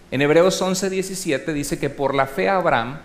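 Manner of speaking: usual speaking voice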